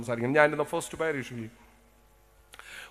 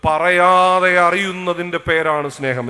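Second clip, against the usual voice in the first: slow and much louder, പറയാതെ stressed; slow emphatic quote; 2 semitones higher, 10 dB louder, much slower than usual. Shown as a demonstration. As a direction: spell out each word for the hall. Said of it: "അത്താനാസ്യൂസ് തിരുമേനി പറഞ്ഞിട്ടുണ്ട്". (പതുക്കെ, ഉറക്കെ, ഊന്നിപ്പറഞ്ഞ്)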